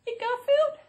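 high cutesy voice. Pretend. Greeting pet, high voice.

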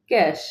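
ক্যাশ ending in a "sh" sound; palatal SH. Shown as a demonstration. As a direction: say ক্যাশ as "kash". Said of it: "তাই তালব্য শ হবে".

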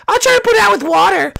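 high, squeaky cartoon voice; Captain Toad. Toad trying to douse the fire, high and urgent.

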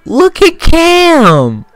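high, drawn-out whiny squeal; little bitch voice. Real, not acted. Gamer reacting to being hurdled, little bitch voice. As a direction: let the voice jump high and stretch it. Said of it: "that was my little bitch voice".

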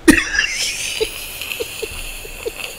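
very high, breathy laughter. Real, not acted. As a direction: dissolve into quiet high giggles. Laughs after fake French.

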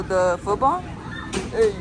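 ordinary talk, nothing marked

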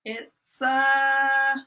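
put-on playful suspense for the kids. Shown as a demonstration. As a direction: stretch the ba- syllables to build suspense. Guessing game, drawn-out syllables.

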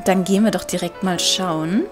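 usual speaking voice

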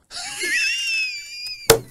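high, piercing burst of laughter; shrieking laugh. Real, not acted. Guest cracking up mid-story, high shrieking laugh. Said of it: "me hiciste llorar".

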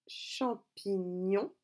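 champignon very slowly, cham-pi-gnon; syllable by syllable. Shown as a demonstration. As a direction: split champignon into three drawn-out syllables. Breaking the word into syllables. (champignon découpé lentement en syllabes)

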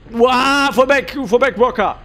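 frantic retreat order. Real, not acted.

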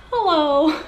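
high, silly greeting to her reflection; playful hello. Real, not acted. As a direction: wave hello to yourself, goofy. Greets own reflection in mirror.

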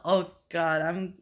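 normal talking voice

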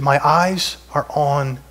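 slowly, each word weighted; slow solemn prayer. Retold or imitated. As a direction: lay the words down slowly. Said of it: "He said".

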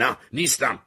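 loud, high protest; shouted denial. A narrator's reading. The boy shouting back at Harry that he's no philosopher, loud denial. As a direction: yell it back at him. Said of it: "داد زدم".

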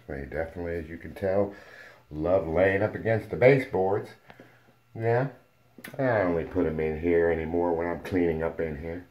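ordinary talk, nothing marked

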